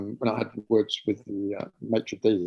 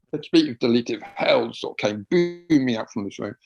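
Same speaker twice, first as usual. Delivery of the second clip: normal talking voice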